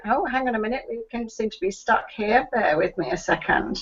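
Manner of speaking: usual speaking voice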